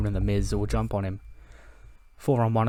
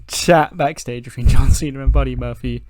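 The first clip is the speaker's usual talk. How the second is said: laughing through words; 3 semitones higher, 7 dB louder than usual. Real, not acted. Laughing at the backstage segment.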